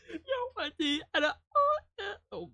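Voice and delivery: high, tearful laughter; laughing hard. Real, not acted. Streamer laughs at his own singing, crying with laughter. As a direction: crack up mid-sentence.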